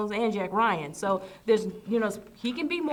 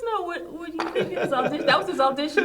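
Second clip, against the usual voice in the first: laughing it out, a little louder; chuckling while mocking the audition; 7 semitones higher, 4 dB louder, faster than usual. Real, not acted. Mocking his co-host's audition while laughing. Teasing co-host after the accent attempt.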